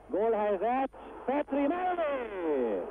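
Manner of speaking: high, slow drawn-out cry; excited call. Real, not acted. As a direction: stretch the final word out high. Near goal, drawn-out call.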